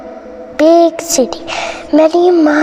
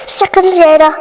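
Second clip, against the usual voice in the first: plain reading voice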